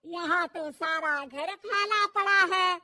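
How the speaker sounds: loud, slow, scolding tirade; mother-in-law ordering daughter-in-law to wash roof; slow angry scolding. Acted. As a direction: drag out each word in anger.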